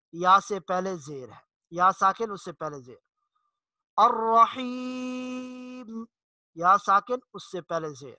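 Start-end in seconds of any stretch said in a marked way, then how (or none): 4.0-6.0 الرحیم slowly, ی drawn long; الرحیم, stretch the yaa; slow chanted الرحیم. Shown as a demonstration. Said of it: "کھینچ کر تھوڑا سا لمبا کر کے پڑھیں گے".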